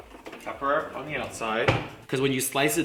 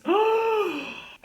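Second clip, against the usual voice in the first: high, sharp gasp of dismay; upset gasp. Real, not acted. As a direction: suck in air in distress. Upset over the broken nail.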